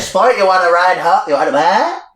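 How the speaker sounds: lightly put-on chummy dad voice; hammed-up dad. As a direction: call out like a dad offering a ride. Voicing the dad pulling up.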